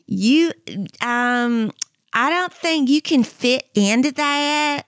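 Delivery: slow Southern drawl, sweetly condescending; mock a mean-nice Southern saleswoman; snooty Southern accent. Saleswoman doubting the clothes will fit, in snooty Southern drawl. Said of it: "nice Southern way".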